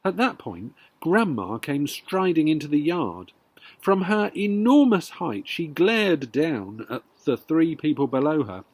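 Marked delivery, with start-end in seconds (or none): none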